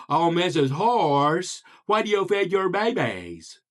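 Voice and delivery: inquisitive frog with a huge mouth; big-mouthed frog. Acted. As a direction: draw out every syllable, mouth wide open. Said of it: "the inquisitive big-mouthed frog".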